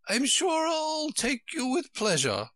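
put-on high, fluttery old queen voice; a high, flustered royal voice. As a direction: play the dithering queen, high and fussy. Queen's voice held by reader.

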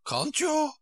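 very high, pitying Queen; high-pitched condescending sympathy. A narrator's reading. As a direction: coo with pity in a high voice. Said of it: "said in a pitying tone".

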